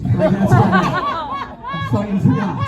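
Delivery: high squealing laugh. Real, not acted. Squealing laugh at own joke.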